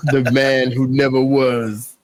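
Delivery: mock trailer voice. Mock dramatic trailer tagline.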